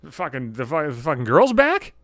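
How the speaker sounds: ordinary delivery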